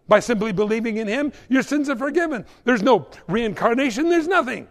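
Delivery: high, loud, rushed protest; exasperated outburst. Acted. Storekeeper fed up with free salvation, exasperated high rant. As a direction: build to a high-pitched rant.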